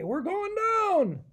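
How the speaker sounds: high, slow, stretched cry; drawn-out pretend crash wail; panicky pilot. Groggy raccoon replays crash.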